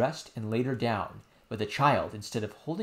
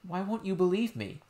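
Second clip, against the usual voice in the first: put-on high child voice, pleading; a little girl explaining earnestly; insistent princess; 8 semitones higher than usual. Princess telling nurse what happened, high and earnest.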